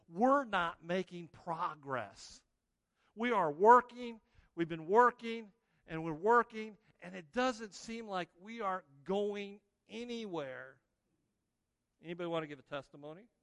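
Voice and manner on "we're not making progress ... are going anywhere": voicing weary rowers, straining hard; a hint of exhausted complaint; strained frustrated disciples.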